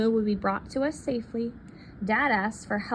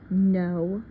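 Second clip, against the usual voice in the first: ordinary delivery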